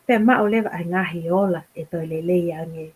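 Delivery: normal talking voice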